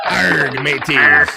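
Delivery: loud pirate Arg, mateys in a put-on pirate accent. Vlogger greets viewers with a loud pirate hail. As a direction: bellow it like a pirate captain. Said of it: "Let's talk like a pirate".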